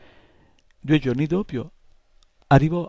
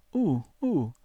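a higher, softer little moan; moaning imp; 5 semitones higher, 3 dB quieter than usual. A narrator's reading. Nightmare imp dismayed at the long wait, soft high moan. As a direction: let the "uh, uh" sink into a whimper. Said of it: "gemette l'incubino".

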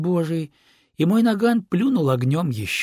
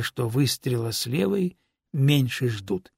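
ordinary delivery

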